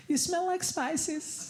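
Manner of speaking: high, slow, awkward voice; mock the clueless stranger. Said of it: "They go".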